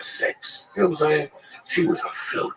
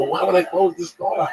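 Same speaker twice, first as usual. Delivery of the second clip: higher-pitched retold protest; mock-indignant comeback; 9 semitones higher, 4 dB louder than usual. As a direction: toss back an incredulous question, pitched up. Acting out his indignant comeback.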